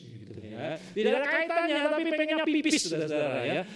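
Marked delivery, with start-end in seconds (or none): 0.8-2.9 comic high emphasis. Acted. Comic example of urge.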